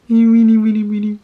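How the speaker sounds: loud mumbled gibberish take-off of yourself; exaggerated, mouth barely opening on the words; mock self-imitation. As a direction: send up your own garbled delivery. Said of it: "Like, I'm talking like".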